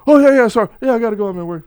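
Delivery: loud, high start settling as it ends; distracted cop. Cop excusing himself from the phone, imitated hasty apology. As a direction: imitate a caught-out officer wrapping up a call.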